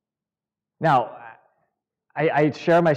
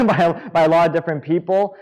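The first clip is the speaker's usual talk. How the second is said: usual speaking voice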